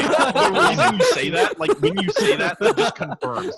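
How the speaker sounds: high, loud laughing accusation; can't stop laughing while accusing. Real, not acted.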